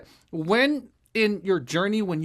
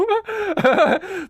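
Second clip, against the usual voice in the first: bright burst of laughter; 11 semitones higher, 4 dB louder than usual. Real, not acted. Host laughs to his guest about the vanished video, a real burst of laughter.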